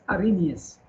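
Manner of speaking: "Arrhenius" said without any H; silent H. Shown as a demonstration. Teacher repeating the name for students, "Arrhenius" with silent H. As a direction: drop the H in "Arrhenius". We don't pronounce the H.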